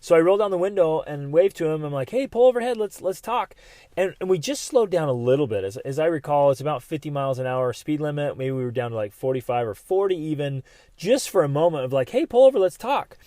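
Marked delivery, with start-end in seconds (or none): 2.1-3.5 high-pitched call to a friend. Retold or imitated. Calling to friend from car window. 12.1-13.2 high, loud call from a car. Retold or imitated. Retold call to friend while driving.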